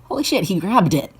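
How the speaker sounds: excited. Real, not acted. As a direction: burst out as the pet grabs it. Pet finally grabs the worm.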